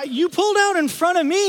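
high, loud indignant retort; acted-out outraged comeback; arguing back. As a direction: shout back in squealing protest. Acts arguing back at the lady.

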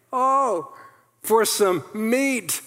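high, drawn-out cry for meat; longing exclamation. Acted. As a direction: stretch it out like starving people begging. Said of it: "they exclaimed".

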